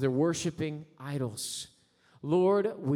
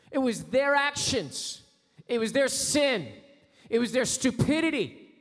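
bitter grievance; 8 semitones higher, 4 dB louder, slower than usual. Pretend.

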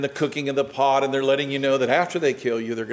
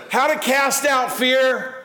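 preaching shout; 9 semitones higher, 4 dB louder, slower than usual. Real, not acted.

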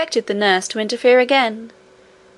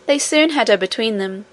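ordinary delivery

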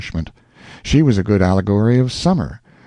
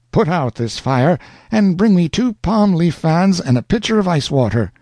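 commanding lady voice; 9 semitones higher than usual. Acted. Aunt orders a servant.

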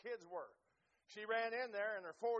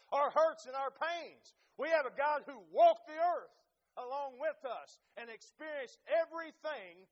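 louder, higher impassioned preaching; preaching at full force; 4 semitones higher, 7 dB louder than usual. Acted. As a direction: drive it home to the congregation.

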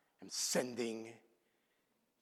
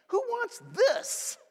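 squeaky high mock question; mock high squeal; slower than usual. Real, not acted. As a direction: ask it in a high, drawn-out squeak.

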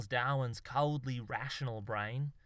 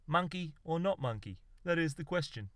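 higher, measured poetic declaiming; brightening up; 4 semitones higher than usual. A narrator's reading. Poet laureate quoting to his mate, brightening up. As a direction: perk up as if on familiar ground. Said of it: "brightening up now they were on more familiar ground".

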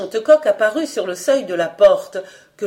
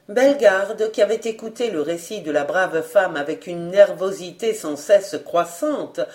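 ordinary talk

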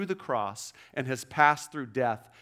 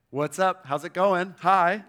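louder, breezy "what's up" for Jesus; casual greeting; 4 semitones higher, 7 dB louder than usual. Retold or imitated. Jesus greeting the women at the tomb, breezy and louder. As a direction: toss off a friendly hello, louder.

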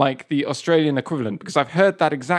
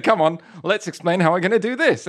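put-on bright, higher, laughing kids' presenter voice; children's TV host; 6 semitones higher than usual. Imitating an Australian kids' TV host for his cohost, laughing and mock-cheery. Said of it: "the host of a children's T V show".

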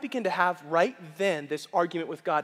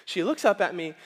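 ordinary talk, nothing marked

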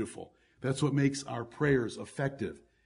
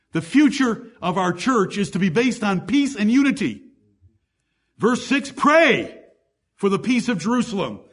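raised, louder preaching voice, pushing each point home; full-force preaching; 9 semitones higher, 10 dB louder than usual. Acted. Pastor urging the church to keep peace, fervent preaching. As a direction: preach it out to the whole congregation.

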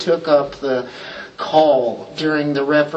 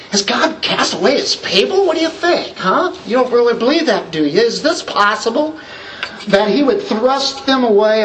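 higher, incredulous rhetorical challenge; acting out Paul's question; 6 semitones higher than usual. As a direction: taunt the listener with the question, pitch climbing. Preacher voices Paul's rhetorical challenge.

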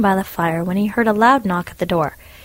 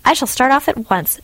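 eager; 7 semitones higher, 3 dB louder than usual. Acted. Hans eager to help.

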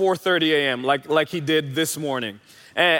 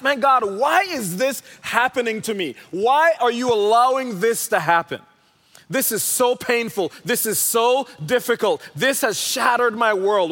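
higher-pitched acted lament; pleading with God; 5 semitones higher than usual. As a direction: plead upward as if questioning God. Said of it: "something where you ask".

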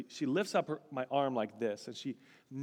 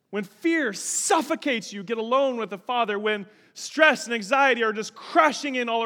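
loud, high, impassioned exhortation; fervent sermon climax; full-force preaching; 8 semitones higher, 10 dB louder than usual. Real, not acted. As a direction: stretch and punch the key words to rouse the congregation.

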